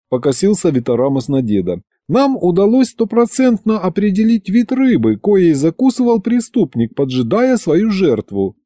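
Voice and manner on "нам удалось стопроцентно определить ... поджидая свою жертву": high-pitched, self-important learned voice; pompous expert. Acted. Vitaramus reporting the fish findings to grandfather, pompous. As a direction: announce the finding in a raised, put-on scholarly voice.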